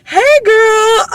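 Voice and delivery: high, drawn-out mock-friendly hello; hammed-up high-pitched greeting; awkward boyfriend greeting. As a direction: imitate an adult overdoing friendliness to a kid.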